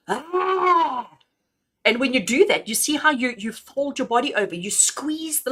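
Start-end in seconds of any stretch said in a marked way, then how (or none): 0.1-1.2 high, drawn-out roaring "ah"; roaring exhale. Shown as a demonstration. Coach shows the class the lion's exhale, high roaring ah. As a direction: let out a lion's roar of breath. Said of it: "actually make a roaring sound".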